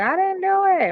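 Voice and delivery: high-pitched imitation of a boy's denial; protesting son. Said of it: "My son was like".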